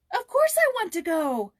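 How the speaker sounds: put-on a girl crying out eagerly at the invitation. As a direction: burst out with delight. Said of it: "cried Joyce".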